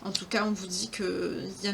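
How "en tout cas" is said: usual speaking voice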